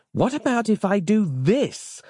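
high-pitched, teasing suggestion; unexpected suggestion. A narrator's reading. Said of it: "Samantha said unexpectedly".

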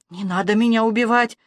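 usual speaking voice

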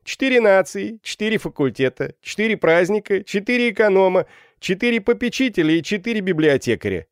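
high-pitched rapid list, called out; crying out. A narrator's reading. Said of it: "крикнул третий". (выкрикивая перечень)

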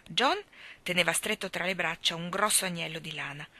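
ordinary delivery, nothing marked

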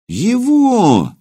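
the prince let down by who he sees; disappointed drawl. A narrator's reading. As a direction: drag it out in disbelief. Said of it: "разочарованно протянул".

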